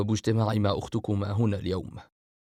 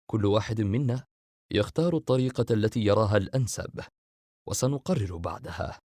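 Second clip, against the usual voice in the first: ordinary talk, nothing marked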